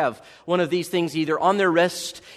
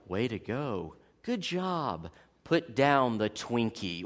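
softer, lower flat gadget voice; fitness tracker voice; 7 semitones lower, 8 dB quieter, slower than usual. Retold or imitated. Preacher imitates a fitness gadget talking to its wearer, softer and lower. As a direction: read it like a tinny device. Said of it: "You're like".

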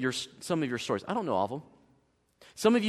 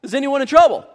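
Is anyone in trouble called out higher and louder; loud high rhetorical call; 8 semitones higher, 8 dB louder, faster than usual. Acted. Preacher calling the question to the congregation, loud. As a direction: throw the question to the room. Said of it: "a bit of a rhetorical question".

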